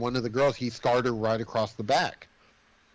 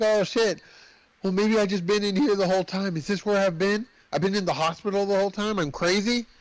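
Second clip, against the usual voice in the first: high, mock-frantic questioning; hammed-up dawning alarm, pitched up; retold panicked realization; 6 semitones higher than usual. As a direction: act out doubting your own sanity. Acting out panicked asylum realization.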